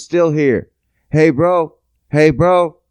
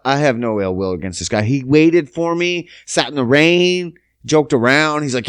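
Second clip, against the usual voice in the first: ordinary delivery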